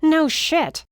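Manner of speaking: high, loud sarcastic snap; snapping. Acted. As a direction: bite the words out. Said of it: "she snapped".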